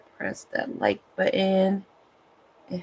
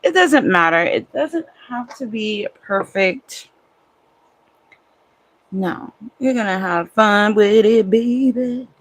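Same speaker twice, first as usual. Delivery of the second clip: loud pep talk; 3 semitones higher, 10 dB louder than usual. Real, not acted. Hyped self-pep talk.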